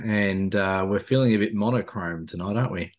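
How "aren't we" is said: normal talking voice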